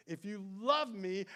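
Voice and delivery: slowed, loud proclamation; emphatic quote. Retold or imitated. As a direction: declare it with weight. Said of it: "Jesus said".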